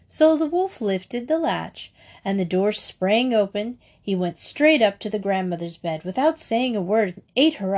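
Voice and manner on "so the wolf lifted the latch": plain reading voice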